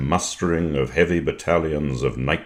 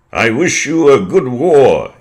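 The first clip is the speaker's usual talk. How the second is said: put-on higher, louder parade-ground voice of an old commodore; old officer; 10 semitones higher, 10 dB louder than usual. Old Air Commodore addressing the parade.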